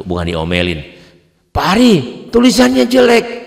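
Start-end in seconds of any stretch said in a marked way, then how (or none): 1.5-3.5 mock scolding lady. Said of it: "diomelin sama seorang ibu".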